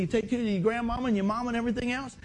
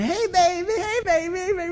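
high, crooning "hey, baby" in a far-off put-on voice; mock lovestruck boyfriend; 11 semitones higher, 4 dB louder than usual. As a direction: croon it like a goofy teen suitor, higher and louder. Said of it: "He acted out me and Denise".

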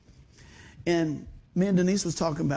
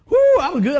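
louder, higher whoo of relief; excited whoo; 9 semitones higher, 11 dB louder, faster than usual. Real, not acted. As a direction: crow about feeling good. Joy recalling good knee.

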